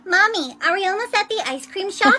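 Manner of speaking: loud, eager question; calling to Mommy. Acted. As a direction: call out loudly to Mommy. Child calling out to mother.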